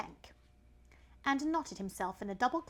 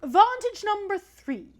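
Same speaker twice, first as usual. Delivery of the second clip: slow, much louder snake voice; loud python; 11 semitones higher, 13 dB louder, slower than usual. Acted. Python rock snake proclaiming. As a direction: declaim it grandly.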